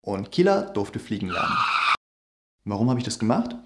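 usual speaking voice

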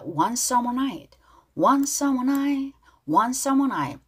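"night" with its final T barely released; slow model phrase, softening the T that ends "night"; weak final T. Shown as a demonstration. Teacher drills the lyric for learners, final T weakened. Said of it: "T로 끝난 단어 약하게 발음한다".

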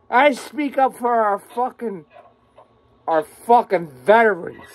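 ordinary talk, nothing marked

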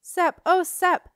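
high, loud and slow with joy; happy cry. Acted. Jenna greeting her brother Sep, crying out happily. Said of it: "Jenna cried happily".

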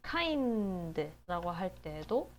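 kind라고 stretched slowly with a rise; slow demo. Shown as a demonstration. Teacher stretches kind for students. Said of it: "kind라고 할 때도 아이의 소리가".

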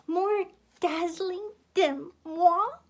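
high, loud, indignant question; affronted diva. A narrator's reading. Miss Piggy affronted by talk of a rival star, indignant diva. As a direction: rise in disbelief on the last word.

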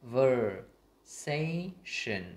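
"conversation" split into con, ver, sa, tion with pauses; very slow broken-up "conversation"; syllable by syllable. Shown as a demonstration. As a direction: separate every sound of "conversation" for learners. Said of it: "tách ra thành các âm".

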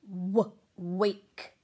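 a drawn-out w leading into wake; w sound. Shown as a demonstration. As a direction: stretch the w before "wake". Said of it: "That starts with a w sound".

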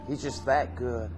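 usual speaking voice

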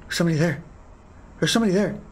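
soft, fast, breathy alarm; frightened hush. Real, not acted. Player spotting a figure in the dark, whispering. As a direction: hush it, scared of being heard.

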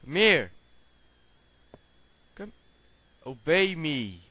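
loud mock command to obey; commanding yell. Real, not acted. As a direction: order the pig, hammed up. Commanding pig.

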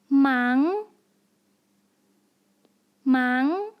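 忙 in a rising second tone; each 忙 climbing like a question. Shown as a demonstration. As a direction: sweep the pitch upward through 忙. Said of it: "second tone".

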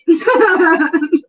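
a loud, high laugh; real laughter at a joke about accents; burst of laughter. Real, not acted.

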